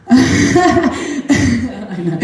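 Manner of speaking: real laugh over the words; laughing with the listener. Real, not acted. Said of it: "you laugh".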